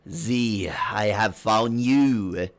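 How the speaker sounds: loud, slow, stretched vowels; grandly drawn-out boy. Acted. The boy lands before the party, loud drawn-out greeting. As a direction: announce it grandly, drawing out each word. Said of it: "the boy hits the ground, and he goes".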